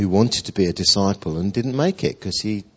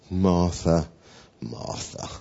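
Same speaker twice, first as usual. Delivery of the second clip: tender; 5 semitones lower, 4 dB quieter, slower than usual. Acted. Jesus gently addressing Martha.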